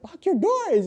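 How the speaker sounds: high, loud urgent call; urgent shout. Retold or imitated. Telling the woman in the car to lock up, urgent shout. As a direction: yell a warning as you run.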